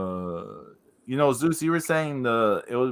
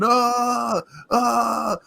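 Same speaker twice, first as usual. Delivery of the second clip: mock running yell; 11 semitones higher than usual. Little wrestlers sprinting to the ring, high mock yelps. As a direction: yelp like tiny wrestlers sprinting.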